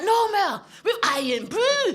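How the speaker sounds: loud, high Scottish outburst; George. Acted. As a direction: object passionately in a drawn-out Scottish accent. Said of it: "this is how George sounded".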